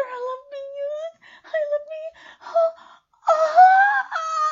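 very high, loud building cries; climax cries. Acted. Sub climaxing for her master, very high loud cries. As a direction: climb to a peak and cry out.

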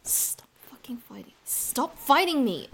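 loud, slow command; forceful order. Acted. Wrestling with partner.

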